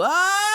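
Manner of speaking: high, held "ah" wail; re-enacted frustrated scream. Reenacting upload troubles to cohosts, high frustrated scream. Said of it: "did I not just go".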